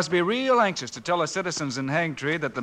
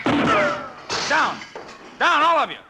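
shouted order; 10 semitones higher, much slower than usual. Acted. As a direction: bark it over the gunfire. Ordering family down under fire.

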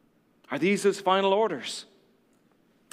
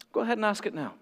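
ordinary talk, nothing marked

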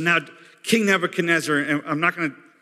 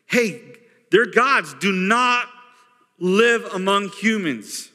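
Hey, their gods do not live among humans, slow and raised; slow emphatic paraphrase; 4 semitones higher, slower than usual. Shown as a demonstration. Preacher paraphrasing the astrologers, slowly and emphatically. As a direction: spell it out slowly for them. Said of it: "That's what they're saying".

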